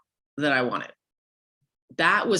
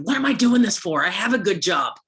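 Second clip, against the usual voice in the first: loud, higher-pitched retold protest; exasperated outburst; 4 semitones higher, 6 dB louder than usual. Daughter complains to her mother on FaceTime, exasperated. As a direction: blurt it out in exasperation, voice up.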